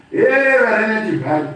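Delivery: usual speaking voice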